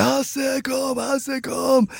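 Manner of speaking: high, drawn-out mock call for help; retold scream. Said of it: "så hör jag han skrika". (återgivet skrik i högt tonläge)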